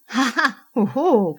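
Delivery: slow, loud mock laugh; sarcastic. A narrator's reading. Husband mocking his wife's favourite authors, sarcastic. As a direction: draw out fake, sneering ha-has. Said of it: "commenta-t-il sarcastique".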